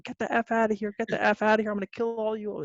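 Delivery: high-pitched imitation of a screaming man; mock screaming. Nephew screaming at everyone at the door, mock screaming. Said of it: "screaming at everyone".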